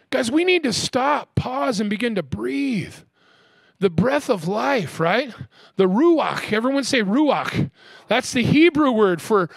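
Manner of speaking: slow, high, swooping preacher delivery; drawn-out, rousing sermon cadence; emphatic preaching. Real, not acted. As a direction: stretch the key words and lift them to the congregation. Preacher builds up emphatic teaching.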